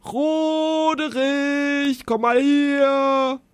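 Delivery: high, slow bellowing call; roaring. A narrator's reading. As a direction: call out, stretching the name. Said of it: "brüllte der sechsköpfige Drache".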